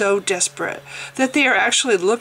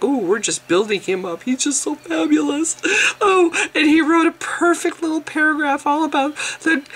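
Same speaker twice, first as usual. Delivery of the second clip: mock gushing; 6 semitones higher than usual. Mocking website praising Josephus.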